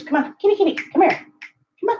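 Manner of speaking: high coaxing call; calling the cat. Real, not acted. Owner coaxing her cat out, high.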